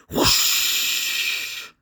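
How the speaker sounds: long unvoiced rushing whoosh; imitated whoosh. Narrator imitating a body flung across the room, whooshing. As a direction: blow out a long airy whoosh.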